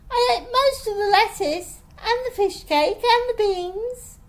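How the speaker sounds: high, loud defensive listing; defensive protest. A narrator's reading. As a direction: insist on each item indignantly. Girl defends herself in quarrel.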